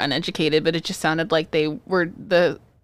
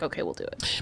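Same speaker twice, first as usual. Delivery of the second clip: put-on whispered kid reply; 9 semitones lower, 12 dB quieter than usual. Kids agreeing to a bribe.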